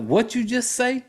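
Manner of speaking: a touch of fury; mild mock anger. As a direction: confront her, half-acted. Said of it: "he's pissed off".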